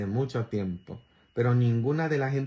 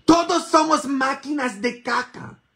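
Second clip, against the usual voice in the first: much higher and louder, hammering it home; loud repeat; 13 semitones higher, 6 dB louder than usual. Shown as a demonstration. Priest shouting the point to his congregation, loud and high. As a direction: shout "Todos somos máquinas de caca" to the congregation. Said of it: "Y todos somos máquinas de caca".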